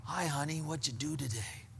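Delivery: low, soft, easy question; quiet, offhand check-in with a spouse; soft casual greeting. Shown as a demonstration. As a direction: greet gently, keeping it low and unpressured. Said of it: "say the exact same words".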